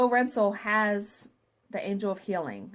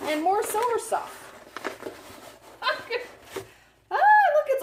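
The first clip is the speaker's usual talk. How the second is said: excited squeal; 12 semitones higher, 6 dB louder than usual. Real, not acted. Squealing over gifts.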